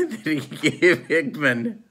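slow words broken by laughter; amused, laughing through the phrase. Real, not acted. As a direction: try to get it out while laughing. Breaks up recalling the mishap.

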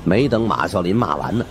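ordinary delivery, nothing marked

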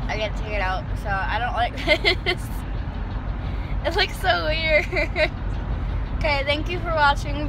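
lips stretched around wire bow; slightly slurred through facebow; talking with headgear in. Real, not acted. Seen 4: Metal facebow across lips, straps on cheeks; absent in refs.

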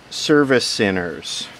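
ordinary delivery

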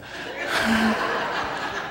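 breathy burst of laughter; sudden amused laugh mid-story; real laugh. Real, not acted. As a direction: laugh at your own joke. Laughs at own joke.

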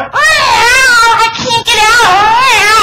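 high, slow mewing cat. Retold or imitated. Retelling her stuck cat's cry to the congregation in a high mewing voice. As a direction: whine it like a trapped kitty. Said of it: "The cat was in the bedroom going".

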